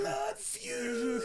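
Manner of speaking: high, loud mock-dramatic "Blood feud"; quoted character line. Quoting his favorite game character, high mock-dramatic.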